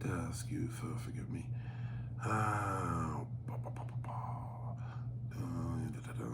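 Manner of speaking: very quiet, to himself; half-whispered filler; absent-minded mumbling. Real, not acted. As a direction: mumble softly while looking through something. Mumbling while scrolling through emails.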